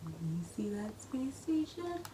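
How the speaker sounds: hushed question whispered. Real, not acted. Peeking in secret.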